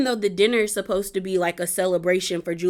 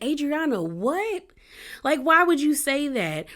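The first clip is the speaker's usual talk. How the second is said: higher, stunned what; shocked disbelief; 6 semitones higher than usual. Retold or imitated. Everyone to Adriana, higher shocked disbelief. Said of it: "And everyone's like".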